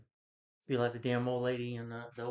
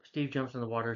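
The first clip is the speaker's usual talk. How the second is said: ordinary talk, nothing marked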